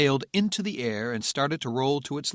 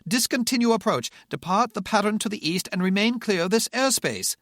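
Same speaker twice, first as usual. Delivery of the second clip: put-on higher, loud British accent, thickening; agitated British accent; 10 semitones higher, 5 dB louder than usual. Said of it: "his British accent getting thicker".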